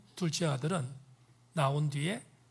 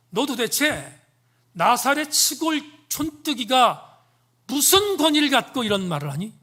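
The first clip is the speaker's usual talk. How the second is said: elders berating a country upstart; indignant challenge; 6 semitones higher, 9 dB louder than usual. Acted. Elders confronting Jesus, loud and indignant. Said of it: "이렇게 따집니다".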